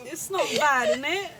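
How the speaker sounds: Australian attempt landing in British; joking British-tinged Australian, half laughing; attempted Australian accent. Shown as a demonstration. Co-host joking about own accent attempt, British-sounding Australian. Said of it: "comes out a little British".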